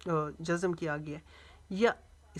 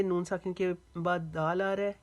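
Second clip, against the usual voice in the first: ordinary delivery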